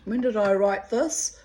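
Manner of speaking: normal talking voice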